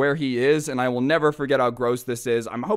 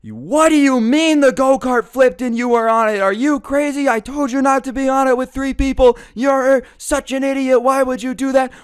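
high, loud scolding tirade, imitated; furious mom; 11 semitones higher, 6 dB louder than usual. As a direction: yell like an outraged mother on a roll. Mom storms in, scolding him.

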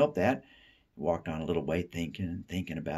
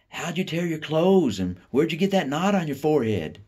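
hollering; 10 semitones higher, 6 dB louder than usual. Acted. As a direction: holler it across the house. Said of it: "they would holler".

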